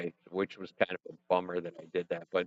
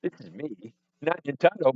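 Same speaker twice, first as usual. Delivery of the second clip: normal talking voice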